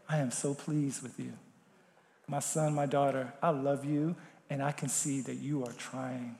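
very soft, tender and low; tender voice of God. Acted. Preacher voicing God's gentle approval, very soft. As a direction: speak it like a loving father.

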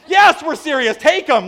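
high, loud mock exasperation; hammed-up emphatic yes; shouted insistence. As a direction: shout back so they finally believe you. Escalating acted exchange with stunned mom.

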